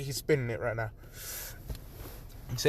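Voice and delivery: plain reading voice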